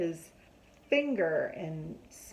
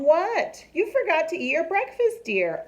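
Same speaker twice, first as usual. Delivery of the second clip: mom's voice; 8 semitones higher, 5 dB louder than usual. A narrator's reading. Mom reminding Froggy.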